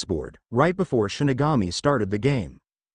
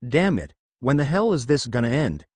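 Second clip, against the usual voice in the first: usual speaking voice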